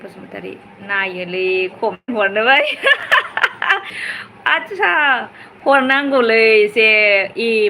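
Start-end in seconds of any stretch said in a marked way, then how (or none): 2.7-4.2 high laugh. Real, not acted. Laughs at a comment.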